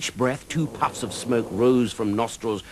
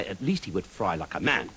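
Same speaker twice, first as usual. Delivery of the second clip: plain reading voice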